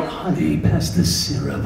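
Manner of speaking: slow husky trailer announcer. Acted. Trailer voice guy talking to his wife at home, announcer voice. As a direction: trailer narrator making breakfast small talk. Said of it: "what's this guy like at home".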